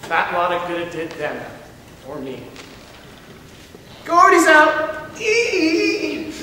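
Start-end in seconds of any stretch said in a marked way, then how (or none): 4.0-6.3 high squealing taunt with a laugh; jeering high laugh at the card table; gloating cackle. Acted. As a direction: crow and cackle at the loser.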